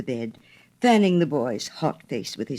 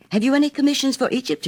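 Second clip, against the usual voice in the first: cried out; 11 semitones higher, 4 dB louder, faster than usual. A narrator's reading. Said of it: "he cried".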